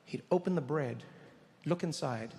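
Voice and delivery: ordinary talk, nothing marked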